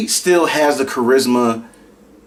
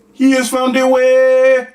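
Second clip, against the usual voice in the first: higher, slow, solemn put-on accent; mock African accent; 10 semitones higher, 4 dB louder, slower than usual. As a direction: proclaim it like a meme prophet. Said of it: "I might as well join in too".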